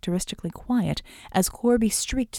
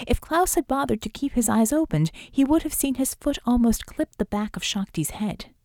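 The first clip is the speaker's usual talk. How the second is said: ordinary delivery, nothing marked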